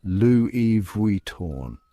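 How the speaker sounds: Louis Vuitton drawn out very slowly as lwee vwee-TON; very slow elegant Louis Vuitton; slow French brand name. Shown as a demonstration. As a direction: linger on each syllable of Louis Vuitton. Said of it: "remember, it's".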